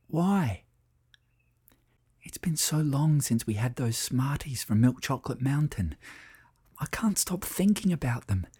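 put-on high, hushed child's whisper. A narrator's reading. Sam whispers to Lucy in bed in the dark, whispering. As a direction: whisper as children awake after bedtime. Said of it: "Sam whispered through the darkness".

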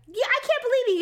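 imitating a colleague's teasing, high-pitched; joking disbelief. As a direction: tease with mock amazement. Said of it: "he was saying it, like, jokingly".

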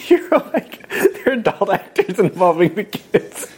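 high, slow words through laughter; laughing. Real, not acted. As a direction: giggle through the repeat. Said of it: "Why is this so funny".

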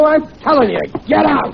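heated command to leave; angry order. Acted. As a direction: throw him out with a shout. Husband throws tutor out.

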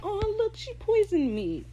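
very high imitated voice; hammed-up high quote; mock delighted discovery. Quotes a poisoned character's reaction.

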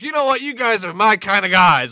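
high, loud wrestling manager voice; Jim Cornette impression. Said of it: "was like".

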